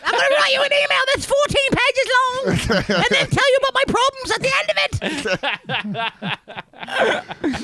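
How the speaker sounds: shrill-pitched clingy Aussie rant; Australian accent. Retold or imitated. Mocking clingy girlfriend.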